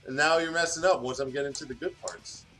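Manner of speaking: ordinary talk, nothing marked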